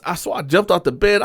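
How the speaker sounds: normal talking voice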